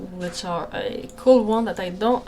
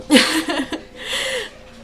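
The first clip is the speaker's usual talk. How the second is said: real laugh; 10 semitones higher, 4 dB louder than usual. Real, not acted. Laughing at her barking dog.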